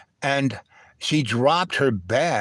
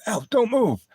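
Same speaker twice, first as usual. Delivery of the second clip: urgent warning; 10 semitones higher than usual. Retold or imitated. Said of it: "and my friend".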